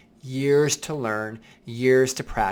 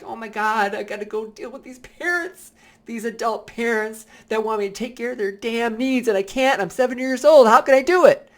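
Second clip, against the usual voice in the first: put-on higher child's voice, rising to a loud cry; overwhelmed seven-year-old; 9 semitones higher, 7 dB louder than usual. Seven-year-old self facing demanding parents, rising to a loud wail. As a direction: build from whiny kid to a loud panicked wail. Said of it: "I'm like".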